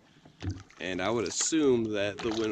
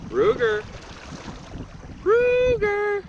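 loud call, rising higher on the second; calling the dog; 4 dB louder, much slower than usual. Real, not acted. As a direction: shout the dog's name across the water.

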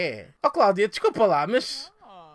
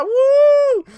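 high, loud yelp of joy; a whoop of delight; excited whoop; 13 dB louder than usual. Real, not acted. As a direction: let out a sudden thrilled whoop. Excited at getting a job.

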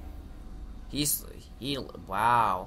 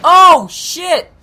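a much higher, much louder yelp of shock; startled shout; 11 semitones higher, 13 dB louder than usual. Real, not acted. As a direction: blurt it out as the hit lands. Gamer startled by attack.

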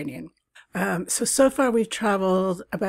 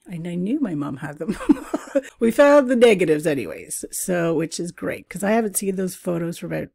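ordinary talk

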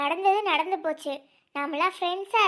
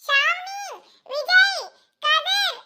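put-on much higher, urgent girl's calling voice; Poomari's voice; 11 semitones higher than usual. Poomari calling friends to come quickly, high urgent voice. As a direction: call the friends over in a high girlish voice. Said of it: "இது பூமாரி voice மாதிரி இல்ல?".

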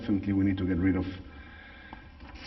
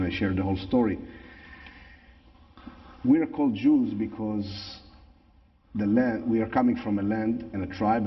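English with a natural Israeli accent. Real, not acted. Same speaker, personal statement.

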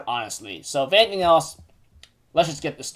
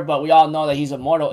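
ordinary talk, nothing marked

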